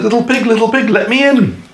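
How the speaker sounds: high singsong knock; teasing wolf-pig. A narrator's reading. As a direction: call in playfully high at the door. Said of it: "and said".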